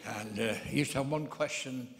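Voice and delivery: ordinary talk, nothing marked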